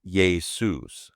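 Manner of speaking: Jesus as yay-SOOS; Greek Jesus. Shown as a demonstration. Host showing how apostles said it: Jesus as yay-SOOS. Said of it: "that's how they pronounced it".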